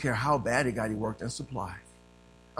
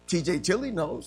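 usual speaking voice

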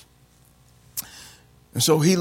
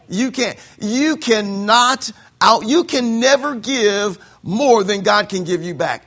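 louder, slow and insistent; emphatic preaching; 5 semitones higher, 5 dB louder, slower than usual. Real, not acted. Preacher insisting to the congregation you can't outgive God. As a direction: drive it home.

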